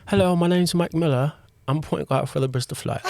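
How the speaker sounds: high-pitched put-on British accent, breaking into amusement; American having a go at sounding British; playful, posh-meets-London British accent; best British accent. Shown as a demonstration. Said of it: "in your best British accent".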